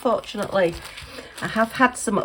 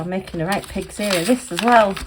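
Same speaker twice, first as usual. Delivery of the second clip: ordinary delivery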